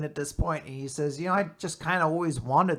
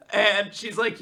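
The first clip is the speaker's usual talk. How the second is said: usual speaking voice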